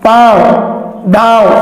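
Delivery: पांव stretched very slowly with a nasal आ; हिंदी: पांव बहुत धीरे, आ नाक से; drawn-out nasal demo. Shown as a demonstration. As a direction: hold पांव long, vowel through the nose. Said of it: "अनुनासिकता का उपयोग किया जाता है".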